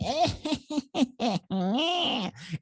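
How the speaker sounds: high, staccato imitated cackle trailing out; creature's laugh. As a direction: mimic a gnome's mocking high cackle. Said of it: "it laughed".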